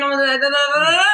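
high, loud flustered da-da-da babble; panicked babble. Retold or imitated. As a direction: sputter nonsense in mock panic.

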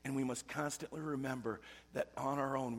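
normal talking voice

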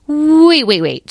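first wait jumps high and loud; excited interruption. Acted. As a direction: stop her mid-speech with eager waits. Young dragon cuts in excitedly.

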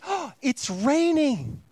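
high, slow, wide-eyed mock astonishment; hammed-up gasp of disbelief; mock surprise. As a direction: play up being shocked by rain. Pastor mocks own surprise at rain.

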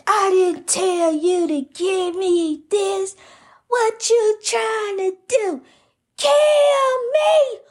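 husband's accusing shriek. Retold or imitated. Wife imitating her husband accusing her of poisoning him, high and rising to a shout. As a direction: mimic the husband's outraged accusation, ending in a yell.

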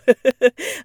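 loud short laugh; real laugh. Real, not acted. Amused by her own dog voice, loud laugh. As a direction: burst out laughing.

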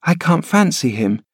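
put-on high, plaintive child voice, pitch rising; a frail boy pleading to stay; sickly boy. Linton protesting to his nurse, high whining boy.

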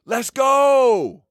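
high-pitched cheer with a long GO; celebratory yell. Shown as a demonstration. Team cheering when someone hits a big shot, excited yell. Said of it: "we yell".

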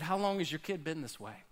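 soft caring question to a worried parent; sympathetic Jesus. Acted. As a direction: ask it tenderly, like you feel the parent's pain. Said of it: "that was Jesus having sympathy for you".

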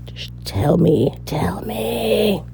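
fading from a soft plea into a breathy whisper; mock pleading whisper. Vlogger teasingly begging viewers to share bloopers, whispering. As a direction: beg them playfully, sinking to a whisper.

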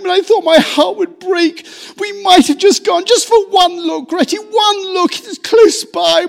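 high, loud tearful outpouring; sobbing lament. A narrator's reading. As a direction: pour out the grief through sobs. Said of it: "he sobbed at last, brokenly".

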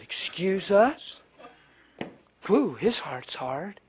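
slow, prissy mock indignation; slow sniffy parody; mock offended. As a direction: sneer like a huffy church lady. Mocking the offended church group.

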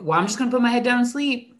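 fast, insistent mock defiance; defiant retort. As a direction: push back like a stubborn student. Student's refusal repeated, escalating.

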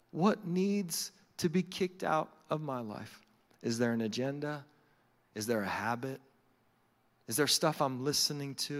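low, soft, searching questions; prayerful. Acted. Praying to God about what to kick out, prayerful. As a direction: ask God quietly and earnestly.

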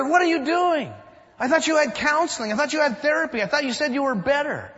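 exasperated bartender. Acted. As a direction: pile up the disbelief. Bartender exasperated, customer throws again.

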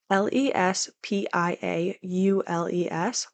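letters spelled one by one, slowly; spelling out. Shown as a demonstration. Vlogger spells the hostel name for viewers, letter by letter. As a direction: spell out L E S P I A U L E S carefully. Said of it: "The first being Les Piaules".

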